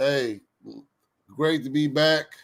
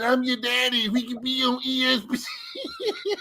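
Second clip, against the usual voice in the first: movie line quote; 7 semitones higher, 4 dB quieter than usual. Retold or imitated. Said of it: "He said".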